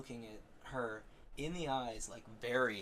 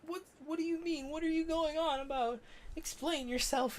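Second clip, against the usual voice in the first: put-on squeaky, much higher demanding girlfriend; a much higher, confused girl demanding an answer; 3 dB louder than usual. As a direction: pitch way up as the puzzled girlfriend. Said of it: "she's like".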